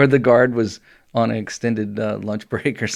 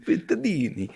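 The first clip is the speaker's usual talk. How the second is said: put-on Italian accent; 8 semitones higher than usual. Echoing the Italian-sounding name.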